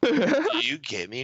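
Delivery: high, slow, drawn-out question; drawn-out acted question. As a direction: stretch it out and pitch it up. Same quote repeated over laughter.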